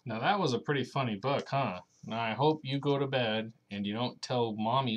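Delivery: usual speaking voice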